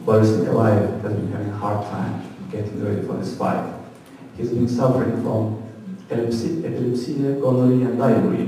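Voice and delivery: low, soft, dramatic sportscaster build-up; American fight commentary. Retold or imitated. Comic mocking US boxing coverage, low dramatic announcer voice. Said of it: "Here's approximate coverage of the same fight in U S".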